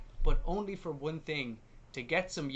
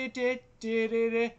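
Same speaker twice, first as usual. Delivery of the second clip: higher sung-out di-di-di; imitated jingle; 6 semitones higher than usual. Retelling Balthazar coming along, imitated jingle. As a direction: vocalise a little fanfare on one syllable.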